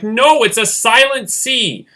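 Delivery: high, slow, exasperated mock correction; annoyed retort. Child correcting dad on a silent C, mock annoyed. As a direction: push back in drawn-out, high-pitched annoyance. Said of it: "I would just get so annoyed".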